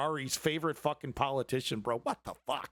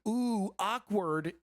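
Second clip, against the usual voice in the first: mock awkward; 4 semitones higher, much slower than usual. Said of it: "And just going".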